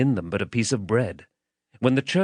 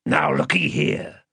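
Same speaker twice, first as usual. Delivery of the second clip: higher, threatening demand; menacing man; 5 semitones higher than usual. Acted. Man demanding the child's name, menacing. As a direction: play a rough interrogator bullying a child.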